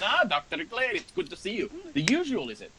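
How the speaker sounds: bright high innkeeper greeting; barmaid Gela, cheerful. Acted. Barmaid welcoming a regular doctor, cheerfully high. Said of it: "Gela gives you a smile and says".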